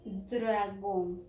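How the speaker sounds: "dragón" with a long first syllable after D-R; blended sounds, stretched. Shown as a demonstration. Teacher shows D and R together, drawing out the word.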